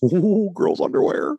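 high, slow, starstruck ooh; mock awed boy. Seventh grade guy gawking at underwear, high and slow. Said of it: "You're like".

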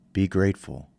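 ordinary delivery

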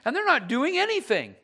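hammed-up resentful volunteer; mock grumbling. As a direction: whine about doing all the work. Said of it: "start grumbling in our heart".

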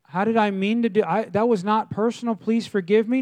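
hammed-up apology under attack; defensive pleading. Pastor pleads with offended churchgoer.

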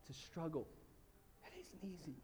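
ordinary delivery

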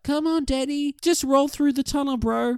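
high-pitched imitation of an urging mate; mock coaxing. Mate urging Danny through the tunnel, high mock coaxing. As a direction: coax in a put-on high voice.

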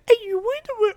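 put-on very high, sing-song voice for a baby. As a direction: squeak it like talking to an infant. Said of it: "the baby voice".

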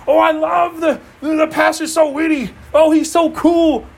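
high, hammed-up fan swooning; mock gushing. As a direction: gush in imitation of a starry-eyed churchgoer. Mimics fans of the pastor.

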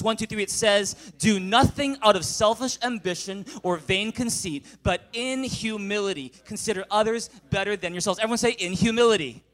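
reading scripture aloud with the crowd, loud; loud congregational reading. Real, not acted. Pastor leading the church in loud reading. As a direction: read it out together, full voice. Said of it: "read in a loud voice".

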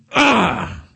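Satan's cry. Pretend. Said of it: "you can see Satan going".

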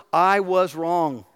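very slow, each word weighed out; drawn-out heartfelt admission; slow and deliberate. Shown as a demonstration. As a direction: spell out the hard words slowly. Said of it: "say those three hard words".

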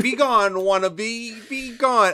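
high, loud hammed-up quote; mocking movie line. Mocking the line again for his cohost. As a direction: sing it out like a cartoon villainess. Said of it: "never heard anyone ever say".

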